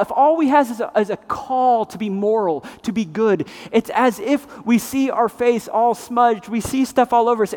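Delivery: ordinary talk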